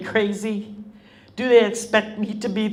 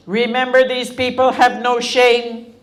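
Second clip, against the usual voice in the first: much louder, higher, a fierce warning; 4 semitones higher, 6 dB louder than usual. Retold or imitated. Grandmother warns the children after the news, loud and fierce. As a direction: hammer each word as a warning.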